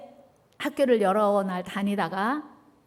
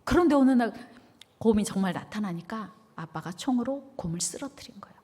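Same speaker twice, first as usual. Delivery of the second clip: ordinary talk, nothing marked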